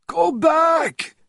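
high frantic scream. A narrator's reading. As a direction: scream a warning. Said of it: "screamed Fontaine".

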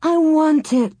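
put-on high, drawn-out childish begging. A narrator's reading. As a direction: wail it like a pleading little girl. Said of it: "Laura begged".

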